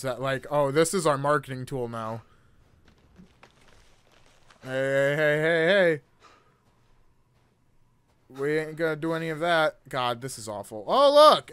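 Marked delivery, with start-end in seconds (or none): 4.6-6.0 hey hey getting louder each time; building protest. Real, not acted. Streamer stopping something. 10.9-11.5 high, loud mock excitement; sarcastic exclamation. Real, not acted.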